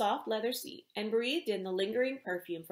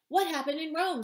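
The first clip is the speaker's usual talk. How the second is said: loud, higher reporter's shout; shouted question; 7 semitones higher, 4 dB louder than usual. A narrator's reading. Paparazzi shouting at the star, a loud yell. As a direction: call out over the crowd. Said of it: "someone shouted".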